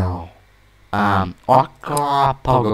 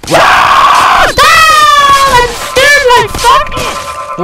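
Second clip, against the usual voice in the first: high screamed outburst at the game; yelling; 5 dB louder, much slower than usual. Real, not acted. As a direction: yell out in shock, voice shooting up. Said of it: "yelling in the microphone".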